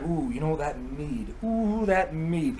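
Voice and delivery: drawn-out, softly longing oohs; coveting, wheedling. Acted. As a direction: savour the thought of it, slowly. Odin acted, longing for the mead.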